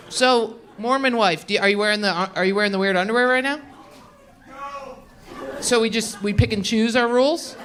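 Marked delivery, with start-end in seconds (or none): none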